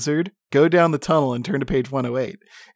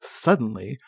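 ordinary talk, nothing marked

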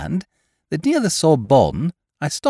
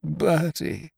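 soft, fervently grateful gurgle; hushed devout relief; 4 semitones higher, 6 dB quieter than usual. A narrator's reading. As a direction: breathe the friend's name like a prayer. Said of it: "he gurgled in a devout sort of tone".

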